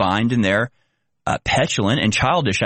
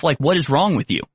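normal talking voice

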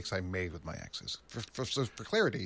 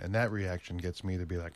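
ordinary delivery

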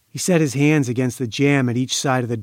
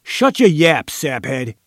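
loud, raised angry command; roaring; 2 semitones higher, 3 dB louder than usual. A narrator's reading. Gang boss roaring his henchman into silence. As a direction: roar it first, drop off on the insult. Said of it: "Diller roared".

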